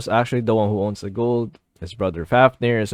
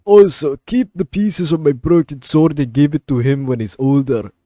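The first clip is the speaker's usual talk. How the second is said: put-on Scottish accent for a dying king; 3 semitones higher, 3 dB louder than usual. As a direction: start loud and high in mock Scottish. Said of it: "I don't know why I'm doing this accent".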